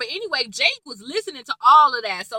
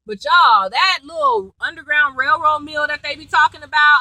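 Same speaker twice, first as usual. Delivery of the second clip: ordinary talk, nothing marked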